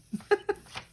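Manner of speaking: high, bright burst of a laugh; quick self-conscious giggle; embarrassed laugh. Real, not acted. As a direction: laugh it off, a little sheepish. Laughing at herself for blubbing.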